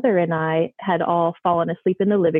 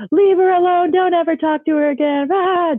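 much higher, louder screamed warning; furious shout; 11 semitones higher, 4 dB louder than usual. Retold or imitated. Mother screaming at the ghost to leave her daughter alone. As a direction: yell at the ghost to back off. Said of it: "flipped out on him and was like".

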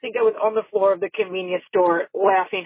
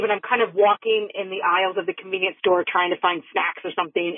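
ordinary talk, nothing marked

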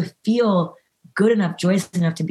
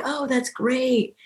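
mock envious "that's great", higher and slow; a hollow, put-on congratulation; imitating a jealous friend's fake praise; fake enthusiasm; 8 semitones higher, much slower than usual. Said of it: "fake and envious".

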